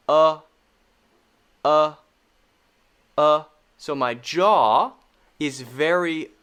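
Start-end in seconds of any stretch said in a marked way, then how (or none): 0.1-3.4 "Uh" loud, tongue still; short U sound. Shown as a demonstration. Teacher showing an unmoving tongue, loud "Uh". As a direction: repeat an isolated "Uh" from a loose jaw. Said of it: "My tongue isn't moving at all".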